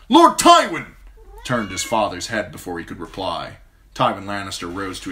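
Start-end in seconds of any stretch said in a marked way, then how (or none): 0.1-1.0 loud, high urgent call; urgent shout. A narrator's reading. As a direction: shout his name across the field. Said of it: "An urgent shout of".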